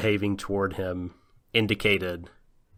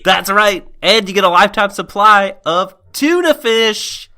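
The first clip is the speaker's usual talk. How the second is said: much higher, louder prize announcer; booming prize announcer; 11 semitones higher, 10 dB louder than usual. Pretend. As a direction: hype the prize to the crowd. Mock game show prizes.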